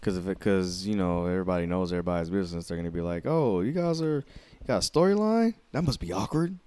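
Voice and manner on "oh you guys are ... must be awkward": high, exaggerated sing-song voice; mock their fake curiosity in a raised pitch; put-on gossipy busybody voice; mimicking nosy people. Mimics people prying into their business.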